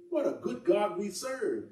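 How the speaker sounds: ordinary delivery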